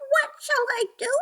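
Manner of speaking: very high, small rabbit voice; a tiny worried rabbit asking; whispering rabbit. A narrator's reading.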